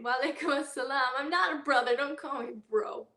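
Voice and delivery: loud joking protest; mock indignant. Real, not acted. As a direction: playfully scold the viewer. Joking correction of a commenter.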